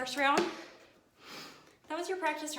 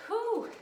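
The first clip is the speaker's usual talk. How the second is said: high exhaled whew; 12 semitones higher, 5 dB louder than usual. Real, not acted. Coach straining in low squat.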